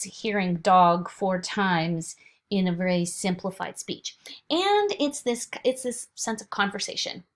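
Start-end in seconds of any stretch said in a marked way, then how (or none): none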